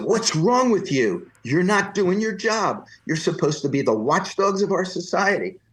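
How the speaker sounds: mock-outraged rant at the reporter; screaming at reporter. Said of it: "began to scream at this man".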